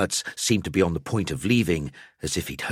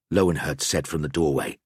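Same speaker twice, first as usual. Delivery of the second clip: ordinary delivery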